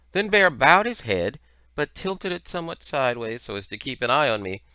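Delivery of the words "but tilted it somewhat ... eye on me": plain reading voice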